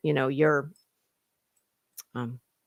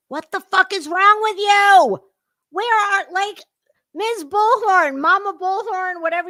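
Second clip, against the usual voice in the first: higher and louder, incredulous; exasperated yell; 9 semitones higher, 5 dB louder than usual. Real, not acted. Commentator yells at the streamer's weird greeting. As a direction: blow up at the screen in disbelief.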